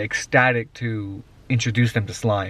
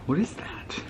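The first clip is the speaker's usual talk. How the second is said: whispering; 5 semitones higher, 10 dB quieter than usual. Real, not acted. Teasing reveal to kids.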